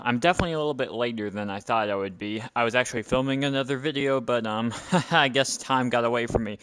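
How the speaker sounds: usual speaking voice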